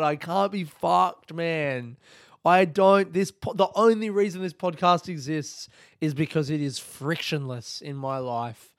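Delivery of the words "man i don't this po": normal talking voice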